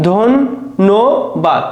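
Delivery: ধন্যবাদ stretched very slowly into three syllables; ধন্যবাদ spoken slowly as ধন, ন্য, বাদ. Shown as a demonstration. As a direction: draw the word out one syllable at a time. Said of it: "এখানে কিন্তু তিনটা অক্ষর".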